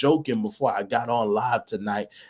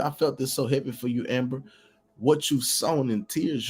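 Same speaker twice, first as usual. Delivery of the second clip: ordinary delivery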